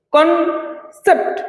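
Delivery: কনসেপ্ট split slowly, কন ... সেপ্ট; syllable by syllable. Shown as a demonstration. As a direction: pause between the two syllables of কনসেপ্ট. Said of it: "দুটো syllable". (দুই ভাগে থেমে কনসেপ্ট)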